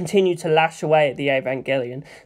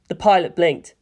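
ordinary delivery

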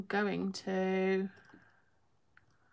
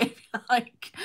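normal talking voice

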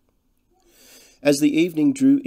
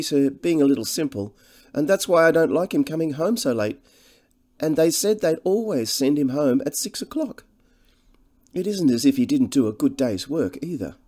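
higher-pitched, deferential countrywoman; humbly fretful; 7 semitones higher, 4 dB louder than usual. A narrator's reading. Cottage woman worrying over Harry being out late, humbly fretful. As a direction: fret humbly in a raised woman's voice. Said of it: "The monotonous voice and the limited range".

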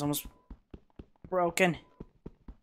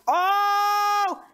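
sudden much higher, louder startled yelp; startled shriek; 6 dB louder than usual. Real, not acted. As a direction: jolt out a scream at the jump scare. Jump scare in Minecraft.

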